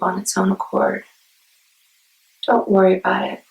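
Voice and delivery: unhurried meditation guide with long pauses; guided meditation. Acted. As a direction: ease listeners into calm, phrase by phrase.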